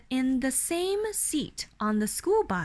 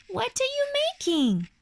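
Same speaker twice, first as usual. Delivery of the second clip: much higher little-sister voice; 11 semitones higher than usual. Acted. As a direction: chirp it like a curious small girl. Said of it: "asked the little sister".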